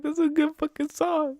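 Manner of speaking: high squealing imitation through laughter; male passenger. Guy defending his song, imitated in a high laughing squeal. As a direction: squeak out his protest, cracking up. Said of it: "He's like".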